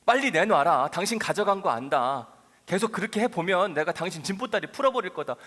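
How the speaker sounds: loud, furious shouting, out of his mind over the money; frantic angry demand. Acted. Robbed traveler threatening the sleeping stranger, furious shout. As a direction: bark the threats at him, half-crazed. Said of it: "막 눈이 뒤집혀 있는 거죠".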